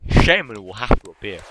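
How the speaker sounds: usual speaking voice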